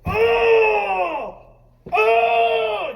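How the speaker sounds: high, very loud drawn-out roar of frustration; angry yell. Acted. Preacher showing what infuriates you, acted angry yell. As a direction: let out two long furious yells. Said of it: "What is making you angry?".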